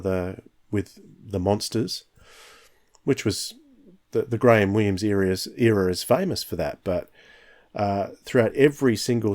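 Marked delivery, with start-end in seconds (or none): none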